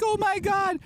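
very high delighted gasp of words; excited squeal. Retold or imitated. Teacher thrilled at former student's visit, high squeal. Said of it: "She's like".

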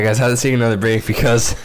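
normal talking voice

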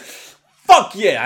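loud high whoop; shout. Real, not acted. Podcaster cheers himself on, shouting. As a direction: bellow it out triumphantly.

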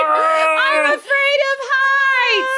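high, stretched-out put-on wail; hammed-up slow high-pitched howl; mock terror. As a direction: drag it out in comic fright. Imitating screaming rider.

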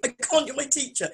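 imitating her younger self. Reliving the teacher reunion, a mock schoolgirl protest. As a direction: squeak it out like a shy pupil. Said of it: "I went".

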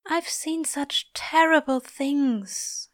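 high, slow, haunted voice; anguished whisper. A narrator's reading. Trembling angel confiding in Crowley, hushed anguish. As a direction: confess it slowly, high and pained. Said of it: "he whispers".

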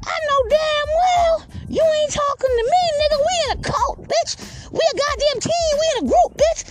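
very high cartoon yapping voice; indignant little dog. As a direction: squeak with outrage like a tiny tough dog. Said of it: "the dog was like".

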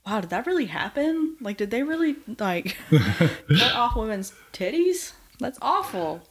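high-pitched, re-enacted incredulous reaction; shocked disbelief. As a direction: act out stunned questions with a laugh breaking in. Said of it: "literally looking at you like".

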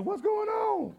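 high, curious call of a bystander; curious onlookers. Retold or imitated. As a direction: pitch it up like a neighbour turning round.

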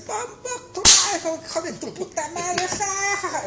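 imitating high, wheezing laughter through words; hammed-up high cackling curse; cracking up. Cook cursing for fun, high mock laughing fit. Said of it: "cuando el güey se cagaba de risa".

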